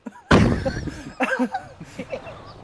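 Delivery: soft, high laughter; helpless laughing that trails on quietly; breathy fit of laughter. Real, not acted. Friend cracking up at the game, high soft laughter.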